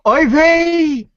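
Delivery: put-on Yiddish accent.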